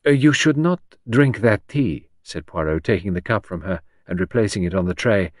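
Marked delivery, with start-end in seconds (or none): 0.1-2.1 slow, higher, louder foreign detective; deliberate cautioning Belgian voice; Poirot's accented warning. A narrator's reading. Poirot stops Henrietta drinking tea, slow accented warning.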